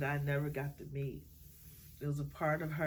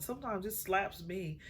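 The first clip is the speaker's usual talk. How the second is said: normal talking voice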